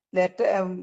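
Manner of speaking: ordinary delivery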